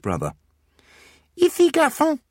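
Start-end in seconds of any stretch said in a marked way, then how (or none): 1.4-2.2 put-on high children chanting Ici, garçon with a lisp; small boys chorus the French; children's chorus. The twins repeat the French phrase in a high chorus. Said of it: "they chorused".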